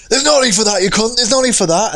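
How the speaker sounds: moped rider yelling at a driver; mock road rage. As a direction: shout it at a rude driver. Retold road rage quarrel.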